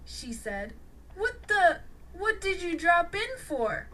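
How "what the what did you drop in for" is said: bewildered. A narrator's reading. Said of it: "said a completely bewildered Tanya".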